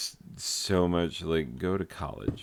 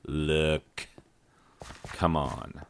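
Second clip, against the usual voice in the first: ordinary delivery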